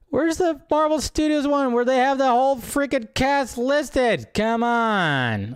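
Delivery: high, loud, slow frustrated whine; fed up with the tabs; exasperated. Real, not acted. Frustrated searching browser tabs.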